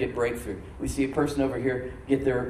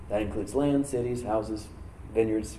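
usual speaking voice

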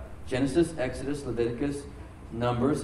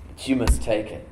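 usual speaking voice

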